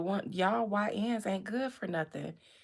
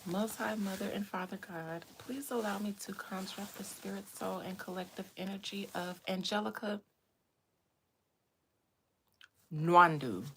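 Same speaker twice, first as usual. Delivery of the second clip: mock prayer; 4 dB quieter than usual. Mock prayer conjuring a spirit.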